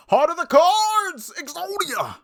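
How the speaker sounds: hammed-up anime shout. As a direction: bellow it like a card duelist.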